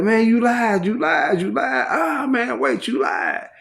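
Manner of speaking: half-hearted yell of an accusation; retold hollering. Neighbor shouting across the cells, a mild mock holler. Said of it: "he hollering".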